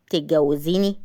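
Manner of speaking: low man's voice; calm young man. A narrator's reading. Asem addresses Tahani before proposing, low and calm.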